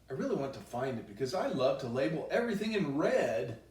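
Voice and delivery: usual speaking voice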